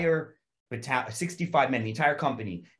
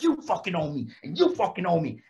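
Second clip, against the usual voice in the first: higher, hammered sergeant threat; drill sergeant in your face; 6 semitones higher than usual. Retold or imitated. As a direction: get in each recruit's face. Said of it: "He's like".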